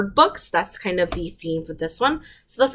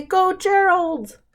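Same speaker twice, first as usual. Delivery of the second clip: cheering; 10 semitones higher, slower than usual. Shown as a demonstration.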